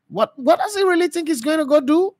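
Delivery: high, disbelieving question; incredulous. Retold or imitated. Host doubting the coach's move, high incredulous question. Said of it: "I was asking myself".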